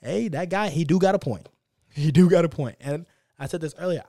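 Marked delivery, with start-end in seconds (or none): 0.0-2.8 higher louder impressed listener; imagined listener. Pretend. A listener agreeing with him, higher and louder. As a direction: nod along, impressed. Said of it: "they're gonna make you like".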